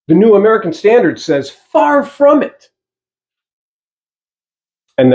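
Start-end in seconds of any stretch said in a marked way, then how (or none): 1.5-2.7 Far from it slow and much higher; emphatic. Shown as a demonstration. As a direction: lift Far from it high and hold it slow. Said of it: "It's emphatic".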